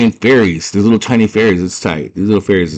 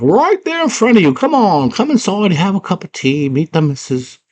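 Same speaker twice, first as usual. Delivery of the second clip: little worm voice; 12 semitones higher than usual. Acted. Worm urging the girl inside, high creature voice. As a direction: point it out as a chirpy little worm. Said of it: "She's like".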